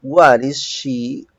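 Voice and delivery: slow, loud teacher model; slow model sentence. Shown as a demonstration. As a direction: spell it out slowly for the class. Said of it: "teacher builds the question". (frase modelo lenta y marcada)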